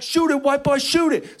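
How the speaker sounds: high yell to shoot; excited teammate yelling shoot it; shouting. Retold or imitated. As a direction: yell at him to let it fly. Teammate yells for the shot.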